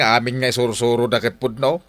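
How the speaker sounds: normal talking voice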